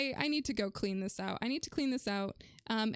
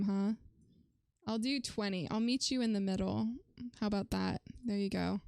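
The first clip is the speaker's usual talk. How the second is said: ordinary talk, nothing marked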